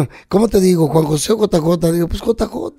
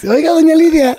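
high, louder put-on voice greeting a lady; mock polite greeting; 10 semitones higher, 5 dB louder than usual. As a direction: call out to the lady in a teasing high voice. Teasingly addressing host as Lidia. (saludo burlón a doña Lidia con voz aguda)

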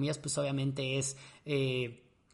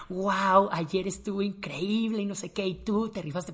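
higher-pitched, gushing congratulations; mock excited praise; 4 semitones higher, 4 dB louder, slower than usual. Strangers hug and congratulate the dancer, imitated excitement. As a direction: gush like an impressed fan.

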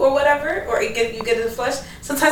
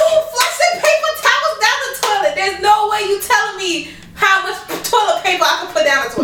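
indignant; 8 semitones higher than usual. Real, not acted. Wife argues back.

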